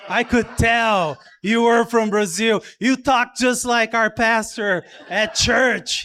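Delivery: a touch of mock triumph; delighted recognition. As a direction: play Lori pleased with her own guess. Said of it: "She's like".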